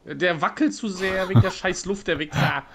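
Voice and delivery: loud, high, fed-up cursing; frustrated yell. Real, not acted. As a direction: yell at the wobbling scope. Frustrated at shaky aim.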